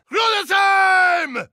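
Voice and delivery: high, slow rallying cry; triumphant shout. Acted. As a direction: call it out like a war cry. Battle cry for hometown.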